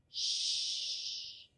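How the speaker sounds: a long whispered shh; soft shushing. Shown as a demonstration. Quieting the children so the baby sleeps, soft shush. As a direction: hush them with a breathy shh. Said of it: "Hagamos silencio".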